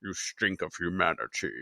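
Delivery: lightly voiced demon judge; menacing judge. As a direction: sneer it quietly like a demon. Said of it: "And he's like".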